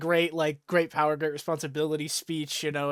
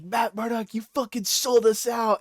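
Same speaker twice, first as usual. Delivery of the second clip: mock angry accusation; 6 semitones higher, 5 dB louder than usual. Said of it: "he's like".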